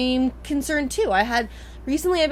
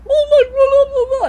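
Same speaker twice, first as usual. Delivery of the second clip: much higher and louder whiny yapping gibberish; dog babbling; 11 dB louder than usual. Retold or imitated. The family dog trying to talk in her dream, whiny yapping. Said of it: "he's like".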